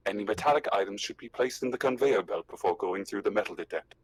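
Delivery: even, synthetic announcement voice; polite automated customs instructions through a voice effect; Working Joe android. Acted. Customs android instructing players at the metal detector, synthetic and formal. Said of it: "working Joes".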